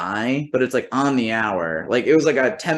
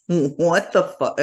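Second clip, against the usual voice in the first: slow, higher-pitched disbelief; stunned, awkward; 6 semitones higher, slower than usual. Retold or imitated. Guest thrown out by the clerk, stunned and awkward. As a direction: drag it out, taken aback. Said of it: "chuckle out of, like, awkwardness".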